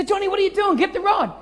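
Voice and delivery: high, loud alarmed call; alarmed dad calling out; yelling at son. Retold or imitated. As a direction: shout to the boy to grab the rod. Yelling at son over lost rod.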